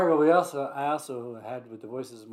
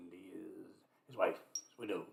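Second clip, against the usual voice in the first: whispering; 6 semitones lower, 12 dB quieter than usual. Acted. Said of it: "let's do a Scottish accent".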